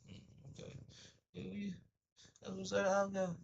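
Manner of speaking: high, soft dismissive "yeah, yeah"; high mock brush-off. Someone who has made up their mind brushing him off, high and dismissive. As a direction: go up high and wave them off.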